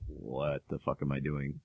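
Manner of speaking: actor's bewildered look. Retold or imitated. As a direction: drop low and soft, as if thinking it. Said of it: "look on his face like".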